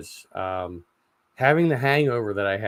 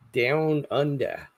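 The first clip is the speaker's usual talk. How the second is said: attempted Australian; 5 semitones higher, much slower than usual. Shown as a demonstration. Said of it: "I can't pronounce it the right way".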